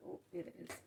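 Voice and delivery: ordinary talk, nothing marked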